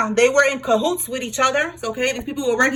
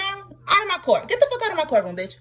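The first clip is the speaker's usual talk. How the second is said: higher, hammed-up judge kicking them out; mock judge; 7 semitones higher than usual. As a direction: throw them out of your courtroom, half joking. Playing judge throwing them out.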